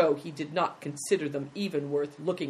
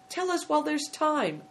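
high-pitched, coaxing plea; pleading; 11 semitones higher than usual. Acted. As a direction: plead with the boy before it's too late. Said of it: "pleaded Big Tiger".

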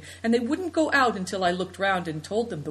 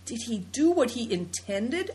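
horrified; 4 semitones higher than usual. A narrator's reading.

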